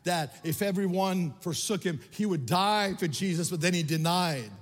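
mounting, high-pitched, impassioned preaching; loud, relentless sermon cadence; full-force preaching. Real, not acted. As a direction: pile up the accusations with rising force.

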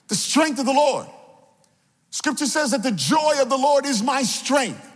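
high, rousing sermon delivery; impassioned preacher's call; preaching. Real, not acted. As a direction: exhort the congregation in rhythmic, falling phrases. Preaches with raised voice.

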